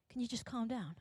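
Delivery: soft, dampening request; calming. Acted. Society squashing ambition, soft and placating. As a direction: quietly put a lid on it.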